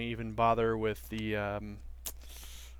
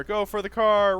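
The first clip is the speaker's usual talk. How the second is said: frantic, high and louder; being chased; 8 semitones higher, 7 dB louder than usual. Real, not acted. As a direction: yell it, running for cover. Fleeing, repeated louder.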